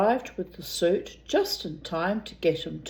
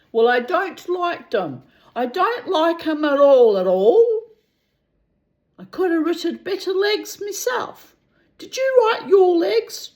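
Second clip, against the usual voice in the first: theatrical Dublin complaint, louder at peaks; Dublin accent; 9 semitones higher, 6 dB louder than usual. Acted. Milligan complains to the author in theatrical Dublin accent. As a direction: sound off like an aggrieved Dubliner. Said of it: "a Dublin accent charged with theatrical innuendo".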